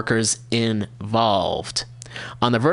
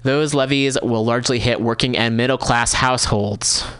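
normal talking voice

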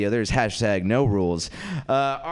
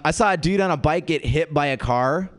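usual speaking voice

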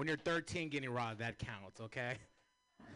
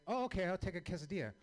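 higher, louder, quick eager yes; eager agreeing; 7 semitones higher, 5 dB louder, faster than usual. Retold or imitated. Ordering the quesadilla, quick and eager. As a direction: jump at the offer, fast.

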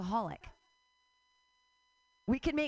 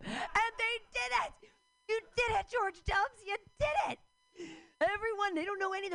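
heated mock cheer; 11 semitones higher than usual. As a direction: cheer them on in furious mock triumph. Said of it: "Sorry, I get so heated".

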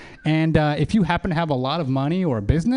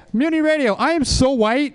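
excited announcer; 8 semitones higher than usual. Acted. Crowd-work chant setup.

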